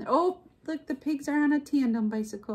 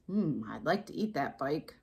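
low, softer pondering goat voice; hungry goat; 6 semitones lower, 6 dB quieter than usual. A narrator's reading. Goat eyeing Duck's bike as food, hungry goat. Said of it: "what he thought was".

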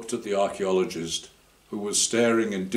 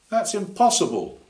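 high piping protest in a higher voice; piping high, incredulous; piped up; 11 semitones higher, 5 dB louder than usual. Acted. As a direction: pipe it up high in disbelief. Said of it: "one of the others piped up".

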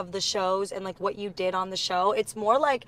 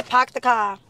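Boston accent; slower than usual. Shown as a demonstration. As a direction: show a Boston accent, R's dropped. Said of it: "I can't do a Boston accent".

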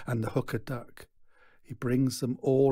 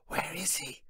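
hushed, high crowd murmur; whisper; 7 semitones higher, 5 dB quieter than usual. A narrator's reading. Crowd whispering about the missing performer, hushed. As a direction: breathe it like gossip in the crowd. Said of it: "is the whisper".

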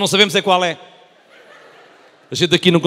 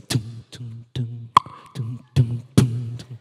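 low sung tum tum beat; imitated beat; 7 semitones lower, much slower than usual. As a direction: hum the beat seductively. Imitating seductive music beat.

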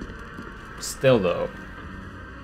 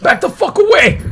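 high, loud panicked yell; screaming at a monster to get away; shrieked warning; 7 dB louder than usual. Real, not acted. Enemy lunging at him.

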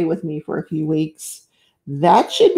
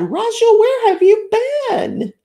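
much higher, louder joyful call of the name; excited shout; 13 semitones higher, 4 dB louder than usual. Real, not acted. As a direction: shout it out, thrilled to see her. Spotting a returning chat friend.

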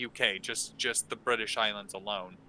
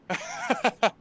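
short high laugh; a quick amused chuckle; light laugh; 12 semitones higher than usual. Real, not acted. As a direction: laugh it off, pleased with yourself. Laugh after being mistaken.

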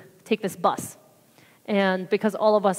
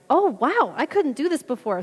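higher-pitched retold amazement; imitating surprise; 5 semitones higher than usual. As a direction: act out her pleasant surprise. Retelling woman's surprise after prayer.

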